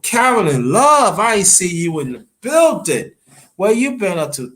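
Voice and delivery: real live-chat welcome; excited shout-out. Real, not acted. Greeting a viewer on stream.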